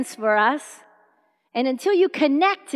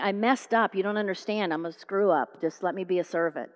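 soft, sinking confession; dejected prodigal son; 5 semitones lower, 7 dB quieter than usual. Acted. Son asking to be a servant, dejected. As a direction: give up on yourself, low and soft.

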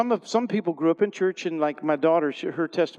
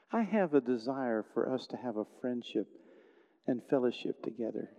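soft, slow and gentle, calling through a wall; tender Jesus voice; 3 dB quieter than usual. Acted. Jesus speaking lovingly to someone walled in, softly. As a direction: coax them tenderly. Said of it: "he goes like this".

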